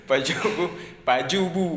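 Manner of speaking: imitating a non-Acehnese saying baju bu, sounds off; clumsy outsider take on "baju bu"; mock outsider accent. Teacher mocks outsiders mangling Acehnese, laughing. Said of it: "Orang kalau nggak bisa bahasa Aceh".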